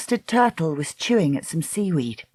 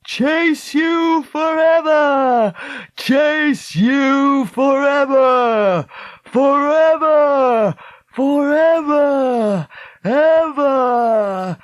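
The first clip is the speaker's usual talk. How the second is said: high, long-drawn wailing echoes; echoing scream; 9 semitones higher, 5 dB louder, much slower than usual. A narrator's reading. The man's threat re-echoes across dark water, echoing scream. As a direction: repeat it like a scream echoing over water, very slow. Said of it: "his voice echoed and re-echoed".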